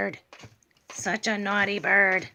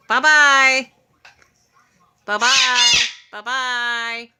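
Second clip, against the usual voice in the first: sing-song bye-bye; 3 semitones higher, 7 dB louder, much slower than usual. Real, not acted. Owner coaxing her bird to say goodbye, loud sing-song. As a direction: call it out for the bird to copy.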